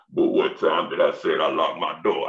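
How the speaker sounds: grandma sounding like Darth Vader in her mask. Retold or imitated. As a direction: breathe it like Vader. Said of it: "Darth Vader games".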